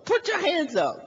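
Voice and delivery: high, urgent yelled command; mock armed-cop yell; shouting policeman. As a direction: order a suspect at gunpoint. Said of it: "he jumps out with his gun".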